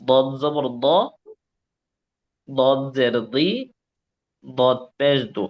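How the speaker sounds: heavy, thickened "দ, দি, দু" for the letter দদ; মোটা উচ্চারণ. Shown as a demonstration. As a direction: spell out "দদ জবর দ" slowly with a full, heavy d. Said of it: "মোটা করে বলতে হবে". (ভারী, মোটা করে "দ, দি, দু" উচ্চারণ)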